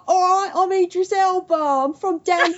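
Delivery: high London accent, hammed up; cheeky Cockney accent impression; Idris Elba impression. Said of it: "Idris Elba's voice in real life because he's like".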